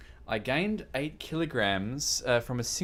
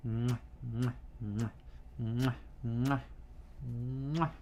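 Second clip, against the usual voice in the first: big wet smooch; 6 dB quieter, slower than usual. Real, not acted. Kissing her fox.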